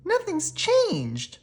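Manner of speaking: put-on high, slow, let-down little girl voice; disappointed little witch. A narrator's reading. Maggie sighing to herself that nothing changed. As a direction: drag it out in dismay. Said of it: "said Maggie".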